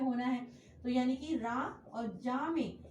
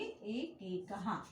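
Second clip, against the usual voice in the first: slow spelled-out answer; 4 semitones lower, 4 dB quieter, much slower than usual. Shown as a demonstration. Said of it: "तो क्या बन जाएगा ये?".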